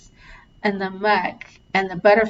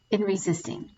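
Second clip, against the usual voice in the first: guided tapping script. Acted. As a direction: read each affirmation like a gentle mantra.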